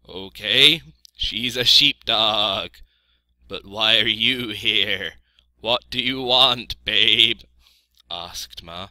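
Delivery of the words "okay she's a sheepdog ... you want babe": loud bleating sheep voice; Ma the sheep, questioning. A narrator's reading. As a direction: question loudly with drawn-out sheep vowels.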